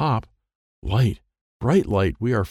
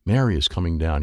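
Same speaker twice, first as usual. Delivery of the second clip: usual speaking voice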